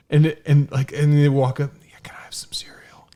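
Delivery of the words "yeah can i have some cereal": imitating a kid's hushed whisper; hushed, voiceless whispered request. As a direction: whisper it like a child asking a small favor. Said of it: "we're all whispering".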